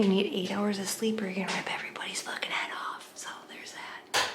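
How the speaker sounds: whispering. Real, not acted. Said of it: "everybody can hear what I'm saying".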